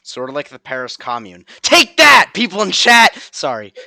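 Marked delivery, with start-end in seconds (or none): 1.6-3.2 sudden high, very loud yell; loud playful outburst; triumphant shout. Pretend. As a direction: yell it at the audience in mock triumph. Streamer calls out chat, then apologises.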